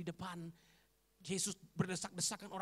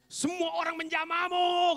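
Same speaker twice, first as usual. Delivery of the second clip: high, slow, drawn-out protest; acted loud protest; 11 semitones higher, 11 dB louder, slower than usual. Disciple answering his teacher's illogical question, loud protest. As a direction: protest loudly, stretching the words.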